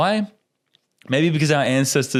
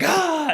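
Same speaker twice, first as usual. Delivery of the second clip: scream. Retold or imitated. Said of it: "I remember screaming".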